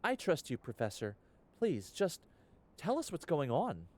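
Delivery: a worried student begging for answers; pleading young heroine. Acted.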